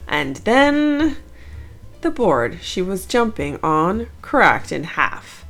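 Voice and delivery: plain reading voice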